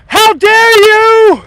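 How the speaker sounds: shrill falsetto screech, very high, loud and slow; mock outraged viewer. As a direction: squeal it like an offended busybody, way up high.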